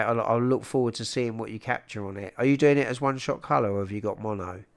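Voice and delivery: ordinary talk, nothing marked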